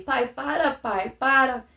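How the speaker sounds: understated retold cry; retold pleading. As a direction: beg him to stop. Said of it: "eu comecei já a chorar e a tremer".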